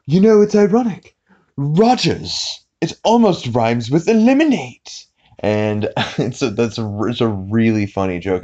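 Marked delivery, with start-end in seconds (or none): 0.1-5.0 high-pitched, theatrical cartoon villain impression; Stewie. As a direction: play a tiny evil mastermind, loud and high. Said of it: "and then he says".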